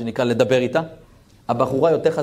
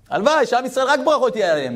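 fervent wish; 10 semitones higher, faster than usual. Real, not acted.

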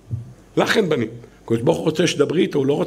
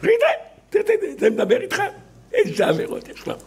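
high, hurried mocking prosecutors; acted scornful heckling; sneering accusers; 4 semitones higher than usual. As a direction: gossip indignantly like jeering accusers. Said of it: "מקטרגים".

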